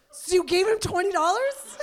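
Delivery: high, loud question through laughter; laughing disbelief. Retold or imitated. Mother asking the woman about the money, laughing and loud. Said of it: "So I'm like".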